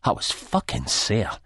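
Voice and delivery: usual speaking voice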